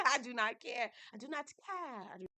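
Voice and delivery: ordinary talk, nothing marked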